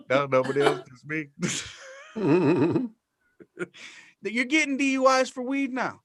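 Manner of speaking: usual speaking voice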